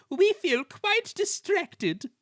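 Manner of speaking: fretful coaches complaining of overwork; distracted coaches. A narrator's reading. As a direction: wail it like flustered coaches. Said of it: "they protested".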